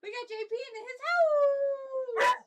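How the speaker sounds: loud, high, slow cheer. Real, not acted. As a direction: hype up the pet, slow and loud. Hypes the dog loudly.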